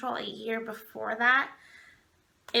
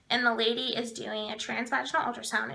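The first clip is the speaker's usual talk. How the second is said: usual speaking voice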